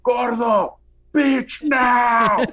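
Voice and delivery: hammed-up accented shout; Guillermo yelling. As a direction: bark the order over the noise in Guillermo's accent. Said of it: "he goes".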